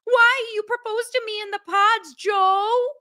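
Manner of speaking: high whiny bride. Retold or imitated. Bride confronting nervous groom, high whining. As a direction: whine his name at him. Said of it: "she's like".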